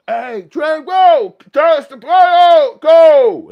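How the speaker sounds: huge, high full-throated yell; yelling inmates. Acted. Inmates yelling to each other through the vents, very loud high yell. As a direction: holler it down the cell block, words stretched long. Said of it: "people yelling to you through the vents".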